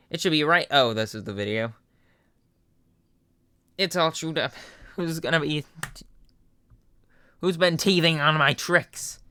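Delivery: mildly outraged Papyrus. Acted. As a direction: search in dismay. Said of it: "Papyrus voice".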